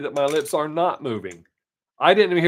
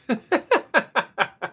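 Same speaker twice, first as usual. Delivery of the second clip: high laugh; 10 semitones higher than usual. Real, not acted. Laughs at own joke.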